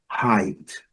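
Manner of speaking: Height as /hait/, silent GH; English height. Shown as a demonstration. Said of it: "o E I com som de I". (Height como /hait/)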